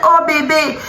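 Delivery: high, hammed-up surprise at her boyfriend; fake sweet surprise. Girlfriend catching her boyfriend, faking sweet surprise. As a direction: feign an innocent shocked "oh bébé". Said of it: "je fais".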